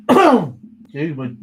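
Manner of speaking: hoarse from a cough; frog in the throat. Real, not acted. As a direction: clear the croak after coughing. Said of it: "frog in the throat".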